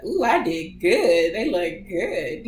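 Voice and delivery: slow, pleased, lightly voiced surgeon; a hint of a self-satisfied doctor admiring her work; proud surgeon. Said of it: "she's so funny".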